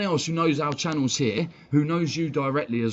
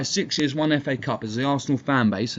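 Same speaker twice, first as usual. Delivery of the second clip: ordinary delivery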